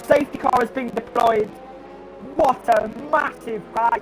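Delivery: shouting high, massive crash; excited crash call. Real, not acted. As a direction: yell out the crash as it happens. Crash called live.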